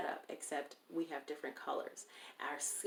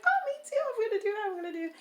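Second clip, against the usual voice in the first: very high, loud squealing mock voice; hammed-up squeal, fading down; imitating her coworker; 11 dB louder, much faster than usual. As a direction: squeal it out like an excited girl. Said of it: "She was like".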